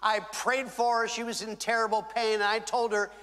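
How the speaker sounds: ordinary talk, nothing marked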